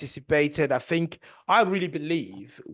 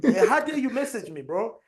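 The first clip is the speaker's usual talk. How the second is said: laughing, raised pitch outraged; laughing mock outrage; 8 semitones higher than usual. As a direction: laugh through a fake outrage. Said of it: "messaging Julian".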